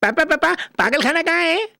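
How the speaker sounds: put-on high, drawn-out childlike voice; childish comic son, naive. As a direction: ask papa in high-pitched innocence.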